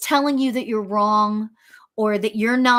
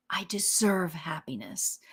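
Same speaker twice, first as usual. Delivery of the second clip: whispered affirmation; 5 semitones lower, 9 dB quieter than usual. Shown as a demonstration. Host whispering an affirmation to her viewers, soft and unvoiced. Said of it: "reciting sample affirmation".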